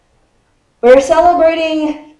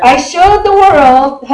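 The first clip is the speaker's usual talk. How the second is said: slow, lightly acted victory boast; a hint of a boastful boxer's voice; Muhammad Ali; 3 semitones higher, 4 dB louder, slower than usual. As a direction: proclaim it slowly like a champion. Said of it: "You have to say it in that voice".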